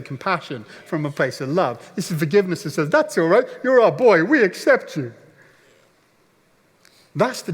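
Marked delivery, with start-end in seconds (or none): 2.9-5.1 high, loud crowd-style call; supportive shout. Retold or imitated. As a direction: shout acceptance like a cheering fan. Re-voices the crowd's forgiving shouts.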